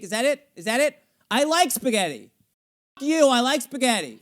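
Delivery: actor's frantic inner monologue; frantic inner panic. Shown as a demonstration. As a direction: spin out in desperate self-doubt. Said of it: "that makes you go".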